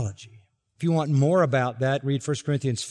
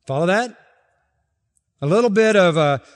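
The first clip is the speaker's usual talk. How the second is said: ordinary talk, nothing marked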